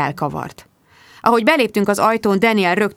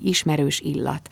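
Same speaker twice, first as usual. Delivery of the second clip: plain reading voice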